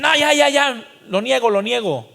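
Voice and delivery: loud, high begging to make it stop; a believer being beaten, yelling out his denial; panicked denial. Retold or imitated. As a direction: cry out under the whip. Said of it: "A los que los azotan y dicen".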